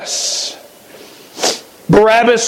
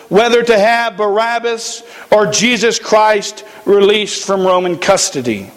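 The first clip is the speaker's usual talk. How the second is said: ordinary delivery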